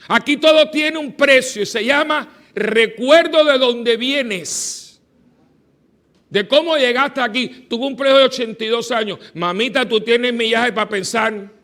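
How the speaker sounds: loud, high emphatic preaching; fiery preaching. Acted. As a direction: preach it to the congregation with fire. Preaching continues.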